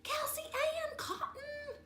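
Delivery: high, slow call upward; calling her sister up a tree; yelled up a tree. A narrator's reading. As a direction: holler up into the tree. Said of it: "I yelled up the backyard apple tree".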